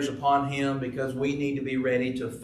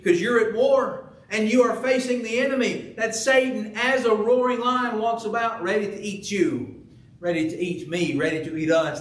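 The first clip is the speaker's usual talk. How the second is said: fiery preaching; 6 semitones higher than usual. Acted. Preacher warning the church about Satan, fiery then fading. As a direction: warn them of the roaring lion.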